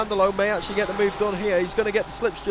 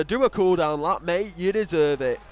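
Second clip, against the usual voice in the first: ordinary delivery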